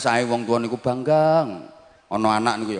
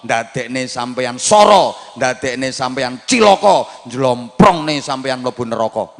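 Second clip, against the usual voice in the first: stack the warnings louder each time; building warning; 5 dB louder than usual. Acted. Preacher warns parents about wrong education, building loud.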